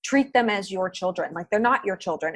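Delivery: ordinary talk, nothing marked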